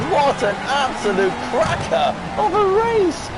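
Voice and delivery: thrilled exclamation. Real, not acted. Racer hyped after a photo-finish race, thrilled exclamation. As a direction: shout your joy at the finish.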